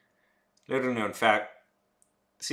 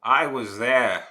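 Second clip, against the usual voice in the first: emphatic; 4 semitones higher, 12 dB louder, slower than usual. Acted.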